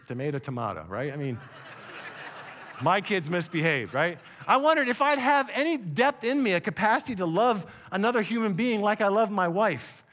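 normal talking voice

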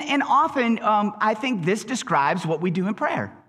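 ordinary delivery

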